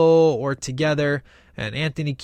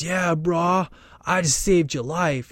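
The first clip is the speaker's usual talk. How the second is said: laid-back surfer dude voice; stoned surfer; Bill and Ted surfer; 2 semitones higher than usual. Retold or imitated. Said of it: "talking exactly like, you know, Bill and Ted".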